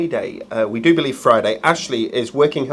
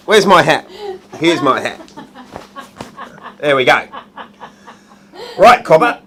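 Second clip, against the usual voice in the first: loud, hammed-up Australian accent; 3 semitones higher, 5 dB louder than usual. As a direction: call it out in a mock Aussie accent. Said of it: "Say it in Australian".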